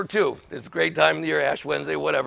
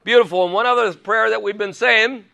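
ordinary delivery

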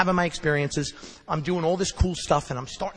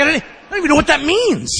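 higher, louder, mock-baffled voice; baffled sponsor; 9 semitones higher, 8 dB louder than usual. Sponsor loudly baffled by the B S amends, higher pitched. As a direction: play the sponsor, loudly bewildered. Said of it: "He's like".

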